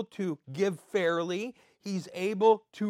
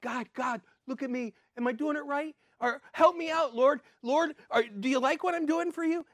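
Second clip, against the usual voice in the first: eager pleading prayer; 7 semitones higher than usual. Retold or imitated. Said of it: "this longing desire inside of us".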